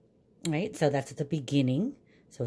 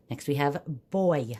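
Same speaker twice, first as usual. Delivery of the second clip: normal talking voice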